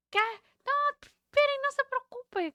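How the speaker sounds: excited high greeting. Real, not acted.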